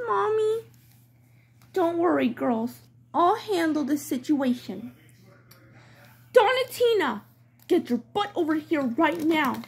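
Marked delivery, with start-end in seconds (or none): 1.7-9.6 lower, firm mother voice; mother doll calling her naughty daughter; stern mom. Acted. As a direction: take charge like a stern mom.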